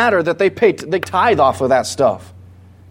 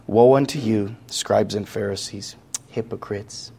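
soft and much quieter, fading to near silence; soft meek voice; 8 semitones lower, 7 dB quieter than usual. Shown as a demonstration. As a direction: say it like a timid, gentle man who won't offend. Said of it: "do we really think that Jesus said it like this".

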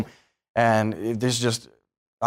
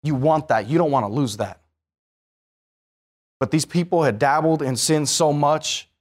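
usual speaking voice

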